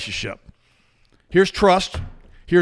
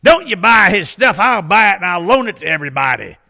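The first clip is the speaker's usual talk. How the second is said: bossy churchgoer; 6 semitones higher, 9 dB louder than usual. Retold or imitated. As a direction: boss the whole room like a self-important lady. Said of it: "and she goes".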